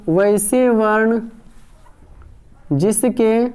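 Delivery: slow word-by-word dictation with long pauses; teacher dictating notes, slow and measured. Acted. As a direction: give each word time so the class can write it down. Dictates definition slowly for copying.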